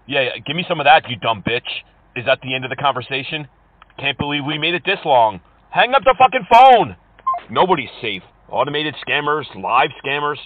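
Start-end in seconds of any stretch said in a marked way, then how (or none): 5.7-6.9 high, loud, exasperated yell; furious shout. Real, not acted. Caller fed up with a long sales pitch, furious shout. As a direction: yell it to end the call.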